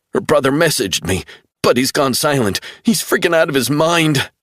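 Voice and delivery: panicked, voice tight; frightened young man. A narrator's reading. Travell frightened.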